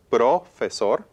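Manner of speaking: "profesor" with wrong stress on PRO, drawn out slowly; stress on first syllable. Shown as a demonstration. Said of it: "we'll start from left to right".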